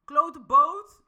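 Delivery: loud, high mock outburst at a boat; cursing a boat, half joking; mock yell at a boat. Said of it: "Wat ga je tegen een boot zeggen?".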